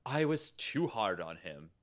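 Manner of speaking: slow, rueful read; rueful Xavier. Acted. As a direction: sound sorry for pushing him away. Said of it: "Xavier turns ruefully to Wolverine".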